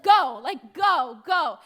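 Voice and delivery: loud shoo, go go go; shooing the cows. Retold or imitated. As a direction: drive the cows off with a loud call. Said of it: "smack the cow's butt and say".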